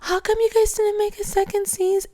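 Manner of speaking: mocking gushing fan. Vlogger mocking fans who suddenly love the show, high gushing voice. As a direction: gush like a late convert fan. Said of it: "they're gonna be like".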